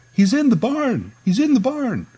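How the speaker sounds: put-on high, eager kids' shout; kids yelling. Kids yelling to the clown, high and eager. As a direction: shout it up to the TV like a child. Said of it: "all the kids would yell".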